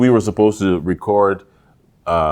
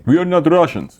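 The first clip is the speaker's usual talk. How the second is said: mock angry Slovakian; 10 semitones higher, 5 dB louder than usual.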